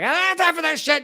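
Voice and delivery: high, rushed, exasperated dismissal; mock impatient. User clicking agree to get past the pop-up, mock impatient.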